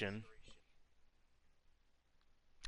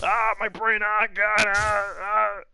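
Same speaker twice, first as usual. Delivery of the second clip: high, loud anguished groans; mind break; 9 semitones higher, 6 dB louder, slower than usual. Pretend. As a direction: ham up a brain breaking down. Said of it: "The noises you're making".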